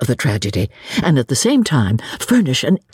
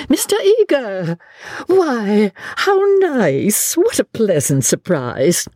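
high-pitched, effusive greeting; delighted surprise; 9 semitones higher, slower than usual. Acted. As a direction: exclaim high, as if happily caught unawares. Miss Bartlett greets chaplain unexpectedly.

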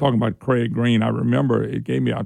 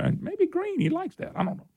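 normal talking voice

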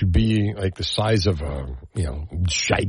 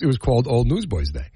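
normal talking voice

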